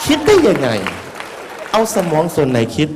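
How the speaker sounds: a monk marvelling out loud at a silly coffin promotion; amazed exclamation. Real, not acted. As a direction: cry out in disbelief.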